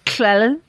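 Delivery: "Clelyn" said with a Welsh lateral "ll" sound at the start; Welsh pronunciation. Shown as a demonstration. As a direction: say "Clelyn" the Welsh way, the "ll" hissed at the sides of the tongue. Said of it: "I can say Llewellyn in Welsh".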